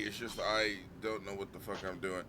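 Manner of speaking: ordinary talk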